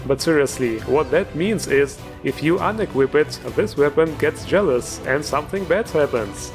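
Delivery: ordinary talk, nothing marked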